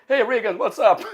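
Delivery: high, breezy shout-out to Reagan; too-casual greeting. Retold or imitated. Guest greeting the ex-president at the podium, too casual. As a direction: hail him like an old buddy. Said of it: "they don't talk like that".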